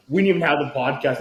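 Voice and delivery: ordinary delivery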